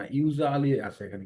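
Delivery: normal talking voice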